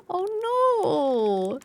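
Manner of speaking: long, playful "aww" tone of dismay; mock dismay. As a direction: wail it lightly in sympathy. Mock dismay at game character.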